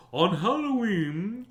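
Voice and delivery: slow, high-pitched spooky storybook opening; Halloween voice. Shown as a demonstration. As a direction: draw it out loudly in a Halloween voice. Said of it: "I'll read a Halloween voice".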